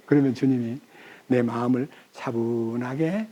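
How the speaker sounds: ordinary delivery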